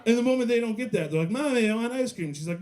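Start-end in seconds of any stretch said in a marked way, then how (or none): 1.3-2.3 put-on high whiny child voice, pitched way up; whiny kid. Kid begging mom for ice cream, high whine.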